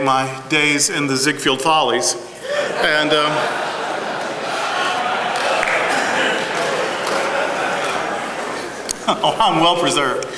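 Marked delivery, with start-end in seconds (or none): 3.4-9.2 airy self-amused laugh. Real, not acted. Joking about Ziegfeld Follies.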